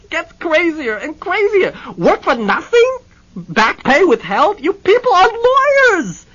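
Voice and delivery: loud, high, slow sputtering disbelief; incredulous client shouting; sputtering outrage. Acted. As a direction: splutter with indignation. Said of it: "Hennessy cried, sputtering".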